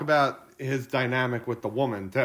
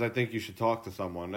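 deadpan hitman; 8 semitones lower, 10 dB quieter than usual. Retold or imitated. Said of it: "Even Mister French says".

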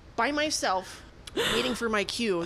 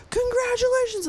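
imitated crowd cheer. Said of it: "And then everyone's like".

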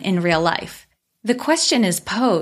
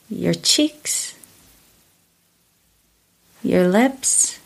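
calm meditative tone; 3 semitones lower than usual. Acted. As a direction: ease them into stillness, unhurried. Leads a guided body scan.

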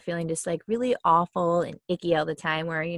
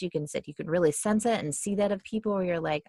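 ordinary talk

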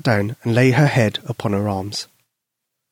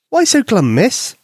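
mother voice; 10 semitones higher, slower than usual. Acted. Mother asks daughter.

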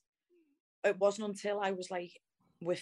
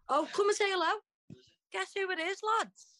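much higher pitch, calling someone over; high-pitched, delighted hello; excited greeting; 12 semitones higher than usual. Real, not acted. Greets a friend on a call.

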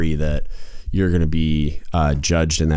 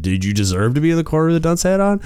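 higher, faster mock disbelief; mock incredulous chief; 9 semitones higher, faster than usual. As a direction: ask it like a skeptical chief who can't believe it. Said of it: "'Cause then they're gonna wonder".

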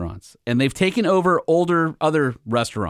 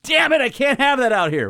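high-pitched exasperated craving; mock frustration; 9 semitones higher than usual. Host voicing a guest craving food in Georgia, mock frustration. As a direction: complain in a raised pitch, hammed up a little.

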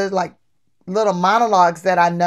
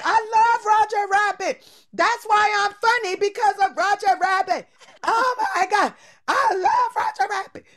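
much higher, laughing, gushing fan; excited gushing; 12 semitones higher than usual. Retold or imitated. Girl gushing to Charles Fleischer, giddy laughing excitement. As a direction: gush with giddy laughing delight.

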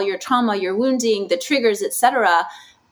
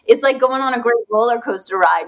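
ordinary talk

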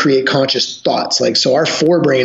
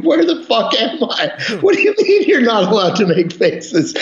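mock horrified, much higher, laughing through it; 7 semitones higher than usual. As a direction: play the shocked visitor while cracking up. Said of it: "like, horrified".

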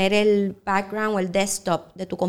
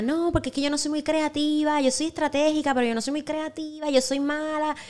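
higher, mock self-doubting whine; imitating her doubting self; 6 semitones higher than usual. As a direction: mimic how she used to put herself down. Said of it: "Yo decía". (imitando con voz más aguda a su antiguo yo inseguro)

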